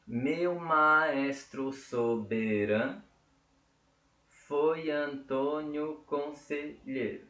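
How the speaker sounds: slow, drawn-out syllables; syllable-by-syllable counting. Shown as a demonstration. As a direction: count the verse out one syllable at a time. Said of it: "tem sete sílabas poéticas".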